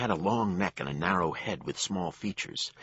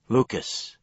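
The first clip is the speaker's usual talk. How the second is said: woman's voice; 6 semitones higher, 5 dB louder than usual. A narrator's reading. Lily calls after gunfight.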